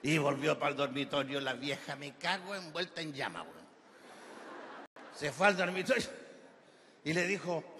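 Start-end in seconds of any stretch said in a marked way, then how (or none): none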